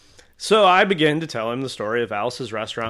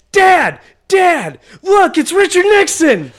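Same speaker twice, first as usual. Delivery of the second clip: high, loud shout for Dad; very excited little boy; 9 dB louder, slower than usual. Retold or imitated. Little boy calling his dad over, excited high shout. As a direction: imitate a kid yelling across the hall. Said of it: "Very excited about Richard Nixon".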